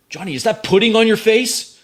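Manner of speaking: high, loud mock-incredulous call; teasing shout. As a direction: holler a jibe across the room. In-character shout across to Johnny.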